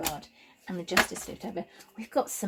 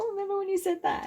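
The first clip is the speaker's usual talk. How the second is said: quick, high-pitched mimicry; high squeaky quote of someone else; imitating others; 13 semitones higher, much faster than usual. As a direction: put on a high, rushed voice for other people. Imitates what people often say.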